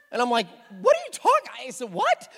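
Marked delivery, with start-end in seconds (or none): 0.8-1.7 high, loud burst of disbelief; incredulous. Retold or imitated. Husband reacts in shock to news. 1.9-2.3 loud, high-pitched "What?"; retold yelp of disbelief; incredulous. Husband reacts in shock to news.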